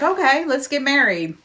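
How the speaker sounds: a hammed-up quick yes; the heroine jumping at the proposal, mock eager. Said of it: "And she's like".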